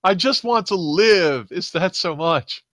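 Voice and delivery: plaintive high-pitched creature; pleading bug alien. Acted. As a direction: plead pitifully, drawing the words out. Pitiful alien pleading with stranger.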